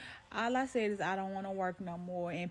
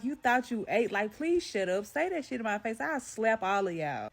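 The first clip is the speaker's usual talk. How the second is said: ordinary delivery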